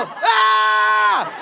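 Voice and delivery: high shrieked yelp; mock scream. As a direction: yelp in fright. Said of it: "I go".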